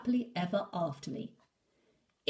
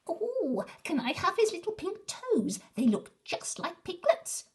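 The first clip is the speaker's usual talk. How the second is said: much higher, squeaky and keen; eager little wolf; 13 semitones higher, 5 dB louder than usual. Acted. Little Wolf begging Big Wolf for the boy's toes, high and eager. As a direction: pipe up like a greedy small pup.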